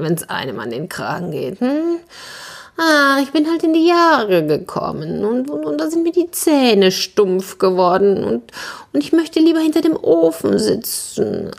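high, plaintive cat voice; high-pitched feline lament, hesitant; aggrieved old cat. Acted.

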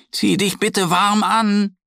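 high, slow call after her cub; a mother calling after her child to dress warmly; calling after him. A narrator's reading. Mother bear calls after Mo, high and slow. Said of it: "ruft ihm die Bärenmama hinterher".

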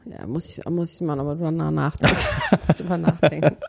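schwere Zunge, leicht lallend. Real, not acted. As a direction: benommen von Migränetabletten nuscheln. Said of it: "Und meine Zunge lallt". (lallt wie sediert)

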